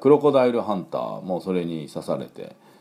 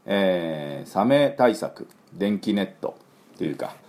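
usual speaking voice

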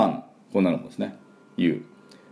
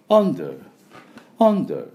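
British under; 9 semitones higher, 4 dB louder, much slower than usual. Shown as a demonstration. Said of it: "underみたいな感じで".

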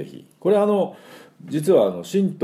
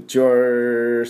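a gruff-ish Japanese sports-club チュース; clipped locker-room hello, チュース; teacher mimicking a sports-club senior's チュース; jock greeting; 4 semitones higher, 4 dB louder, much slower than usual. Shown as a demonstration. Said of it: "男同士の挨拶で".

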